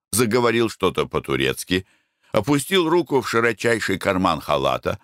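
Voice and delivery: ordinary delivery, nothing marked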